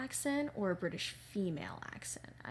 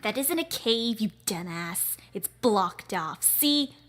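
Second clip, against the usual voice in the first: scornful boss; 3 semitones higher, 8 dB louder than usual. Acted. Boss correcting the henchman about the cave, scornful. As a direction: brush off the lackey with disdain.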